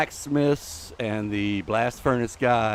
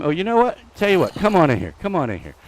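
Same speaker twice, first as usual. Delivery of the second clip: playful taunt; 5 semitones higher, 11 dB louder, faster than usual. Pretend. Taunting an enemy in game.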